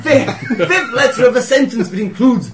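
high, loud, laughing through the words. Real, not acted. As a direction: laugh while correcting yourself. Laughing correction of garbled rule.

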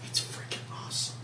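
very soft, voiceless whisper; whispered aside. Real, not acted. Player admiring a friend's armor, whispered aside. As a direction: breathe it out as a whisper.

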